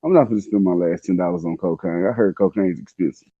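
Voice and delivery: ordinary delivery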